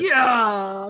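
loud, drawn-out whoop; excited cheer. Real, not acted. Co-host thrilled about the Easter lesson, excited cheer. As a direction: cheer it out with real delight. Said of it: "I'm so excited".

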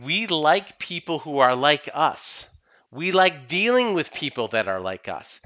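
ordinary delivery

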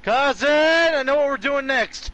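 high, loud excited call; excited shout. Real, not acted. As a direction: call out to your cousin eagerly.

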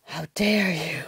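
whispered hammed-up outrage; mock offended whisper. Fan whispering mock outrage at her friend's insult. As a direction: hiss it like a scandalised fan.

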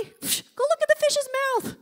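high, carefree scoff; breezy dismissive Jesus. Retold or imitated. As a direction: brush it off like it's nothing. Playful Jesus impression.

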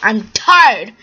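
loud, fed-up outburst; cross. Acted. Gordon is fed up with the trucks, cross. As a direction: complain loudly, hitting the last word hard. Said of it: "said Gordon crossly".

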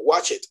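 watch it in two syllables for watched; wrong -ed pronunciation. Shown as a demonstration. Teacher warning students, watched mispronounced as watch it. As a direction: sound the E so watched becomes watch it. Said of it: "no me vayan a decir".

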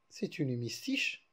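ordinary talk

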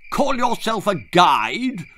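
contemptuous rich man's sneer. Acted. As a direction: sneer down at the hired guide. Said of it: "sneered Mister Crumpdump".

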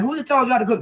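high, loud demanding mom voice; scolding mom. Retold or imitated. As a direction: play the angry mama catching the kid. Mom scolding child over burnt kitchen.

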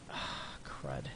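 breathy muttered curse under the breath; a defeated whispered "crud". Real, not acted. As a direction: swear it almost silently to yourself. Quiet curse after failing.

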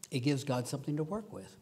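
usual speaking voice

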